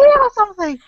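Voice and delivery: exaggerated, comically high-pitched put-on voice; really high pitch. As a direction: push the voice way up high for a joke. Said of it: "Really high".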